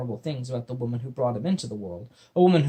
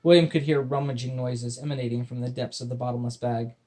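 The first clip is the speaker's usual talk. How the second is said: usual speaking voice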